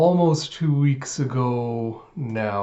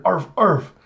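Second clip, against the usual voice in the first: higher-pitched imitated arfs; dog bark; 5 semitones higher than usual. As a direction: yap twice like a dog. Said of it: "And you hear".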